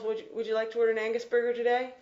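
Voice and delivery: rapid-fire drive-through greeting; very fast. Shown as a demonstration. Teacher playing the drive-through clerk, rattling it off fast. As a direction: blur the words together at top speed. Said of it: "So I'll practice with the student".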